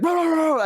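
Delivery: high rapid yapping; imitated dog barking. Roommates barking at each other as a joke, mock dog bark. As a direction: bark like a crazed little dog. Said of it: "like, bark".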